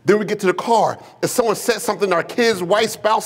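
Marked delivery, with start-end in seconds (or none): none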